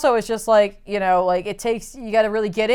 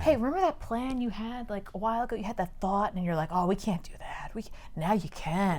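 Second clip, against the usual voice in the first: tempting inner voice; 2 semitones lower, 13 dB quieter than usual. Acted. Inner voice reminds Harvey of his old plan, tempting. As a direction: coax quietly, like a thought in his head.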